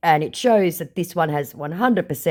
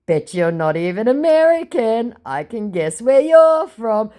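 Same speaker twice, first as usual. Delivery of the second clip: teasing; 7 semitones higher, 5 dB louder than usual. Retold or imitated. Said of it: "I said".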